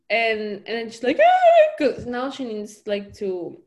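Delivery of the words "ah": high, loud mock squeal; imitated squeal. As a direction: squeal it as the character's reaction. Voices the character's reaction.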